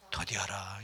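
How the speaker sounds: 더디하라 breathed out as a soft whisper; whispered verse. Shown as a demonstration. Preacher reading the verse to the congregation, whispering 더디하라. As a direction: let 더디하라 fade into a whisper. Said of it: "듣기는 속히 하되 말하기는".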